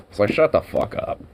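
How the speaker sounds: ordinary talk, nothing marked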